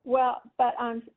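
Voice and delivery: ordinary talk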